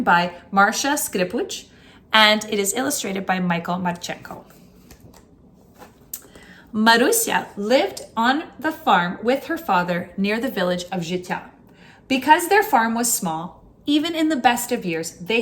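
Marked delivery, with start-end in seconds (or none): none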